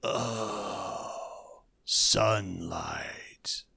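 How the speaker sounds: low whispered monster groan; monster hiss. Retold or imitated. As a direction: hiss it like a dying beast. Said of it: "horrible thing was like".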